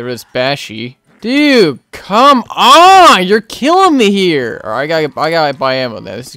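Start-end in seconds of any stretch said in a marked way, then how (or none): 1.2-4.6 loud, high, drawn-out plea; exasperated shout. Real, not acted. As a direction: complain loudly, at the end of your patience. Fed up with the game.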